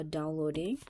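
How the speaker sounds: ordinary talk, nothing marked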